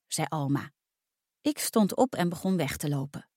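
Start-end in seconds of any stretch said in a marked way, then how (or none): none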